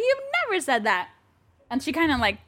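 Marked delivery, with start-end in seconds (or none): 0.0-1.1 incredulous denial. Retold or imitated. Repeats denial, high and soft.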